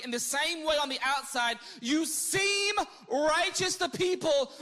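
preacher voicing Jesus' rebuke; rebuking. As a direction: thunder the woe at the hypocrites.

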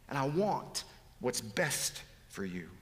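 ordinary talk

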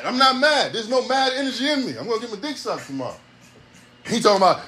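ordinary delivery

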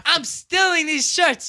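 high, loud, drawn-out exclamation; gleeful mock outburst; retold excited outburst. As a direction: blurt it out loudly, stretching the words. Acted outburst at cheap prices.

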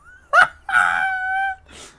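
high, loud burst of laughter. Real, not acted. Player laughing at the old-English translator result, loud high laugh.

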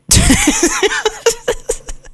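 high, breathy cackle; bursting laugh. Real, not acted. Laughing at his own Kenny Pickett suggestion, high cackle. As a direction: break into helpless high giggles.

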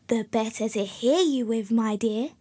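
plain reading voice

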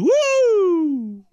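very high, loud wrestler's woo; Ric Flair woo. Retold or imitated. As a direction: let out a wild wrestling-promo woo. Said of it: "Can't leave out a Ric Flair woo".

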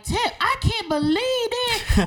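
high, drawn-out mock outrage; fake mad. Faking shock at Tip saying all this, high-pitched fake mad. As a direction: act scandalized in a high, slow put-on voice. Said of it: "y'all now fake mad and like".

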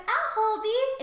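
high, loud, eager little voice; playful pet voice. Retold or imitated. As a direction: pipe up as a grabby pet. Voices the pet grabbing the glasses.

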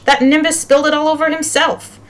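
high, protesting voice; high-pitched tattling protest; indignant accusation. Acted. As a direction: blame him, pitched right up. Accused boy blames the other.

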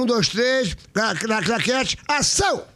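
mock director's call. Host cues guest to perform the scene, mock director's call. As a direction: call out the clapperboard cue like a film director.

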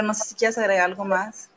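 usual speaking voice

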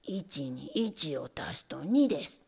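plain reading voice

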